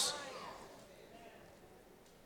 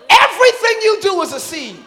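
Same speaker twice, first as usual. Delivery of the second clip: shouting praise at full force; rally the crowd, loud and high; booming motivational speech; 9 semitones higher, 10 dB louder than usual. Acted.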